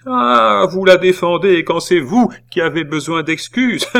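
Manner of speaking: high, loud exclamation; outraged scolding. A narrator's reading. Aunt catching her nephew defending the girl, outraged. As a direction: pounce with an outraged cry.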